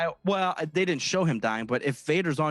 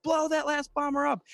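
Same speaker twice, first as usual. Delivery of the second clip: much higher-pitched mock commander's order; First Order commander; 12 semitones higher than usual. First Order commander ordering the last bomber destroyed, high mock command. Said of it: "the First Order commander was like".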